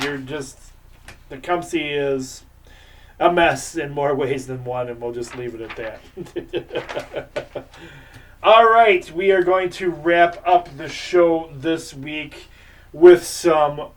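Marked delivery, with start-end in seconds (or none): 6.2-7.6 soft laugh to oneself; chuckle. Real, not acted. Laughing after mocking the team.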